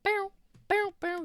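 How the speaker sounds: high loud buzzing hornet; hornet impression. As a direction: buzz like an angry hornet. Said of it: "is that a good hornet impression".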